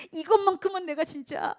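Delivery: high, loud pleading mock voice; 집착하는 흉내. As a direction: whine like someone clinging to pride. (자존심을 놓지 못하는 사람을 높은 목소리로 흉내)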